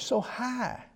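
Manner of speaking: high and light; high-pitched. Shown as a demonstration. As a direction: lift it high to show the angelic range. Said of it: "It was a high-pitched voice".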